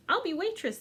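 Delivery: high eager claim; eager girl. Acted. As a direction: call dibs on waitress. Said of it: "said Ruby".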